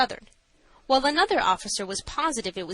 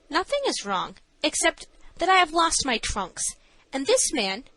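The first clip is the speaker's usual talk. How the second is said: higher-pitched character reply; a light, raised dialogue voice; lightly voiced young heroine; 7 semitones higher than usual. A narrator's reading. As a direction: answer higher, as the girl pleading her case.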